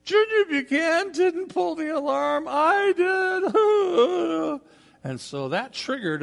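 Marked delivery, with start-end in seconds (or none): 0.0-4.6 high-pitched tearful wailed confession; hammed-up sobbing schoolgirl; mock crying. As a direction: wail it like a guilty little girl, stretching the last word. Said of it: "she's crying, and she's saying".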